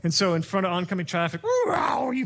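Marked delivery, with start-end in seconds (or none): 1.7-2.2 excited exclaim. Retold or imitated. Swerving left turn in traffic.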